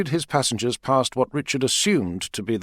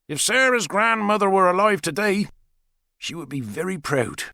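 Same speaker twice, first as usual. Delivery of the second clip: higher, wry father telling a story; father character; 10 semitones higher than usual. A narrator's reading. Dave tells Richard about protest holidays, wry and higher. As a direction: spin an old yarn, dry and amused.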